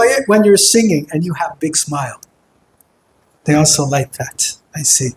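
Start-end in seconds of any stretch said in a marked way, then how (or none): none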